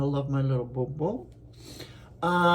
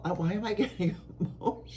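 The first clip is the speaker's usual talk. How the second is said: laughing through tears; 4 semitones higher, 5 dB quieter, faster than usual. Real, not acted. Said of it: "I'm getting so emotional".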